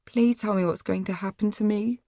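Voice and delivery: soft, tearful begging; sobbing plea. A narrator's reading. Captive girl begging her captors, sobbing softly. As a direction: beg through tears, quietly. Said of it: "I sobbed".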